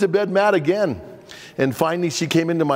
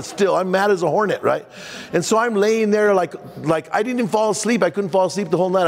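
ordinary talk

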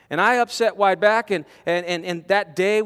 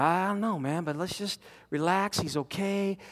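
soothing; 2 semitones lower, 9 dB quieter than usual. Retold or imitated. Chaplain soothing a frightened mother.

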